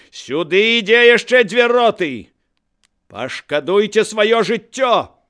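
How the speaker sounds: high, loud warning shout; straining roar. A narrator's reading. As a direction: bellow at a crowd to back off. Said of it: "Ён напінаўся і роў".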